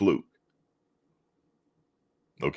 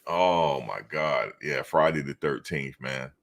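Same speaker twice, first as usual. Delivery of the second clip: ordinary talk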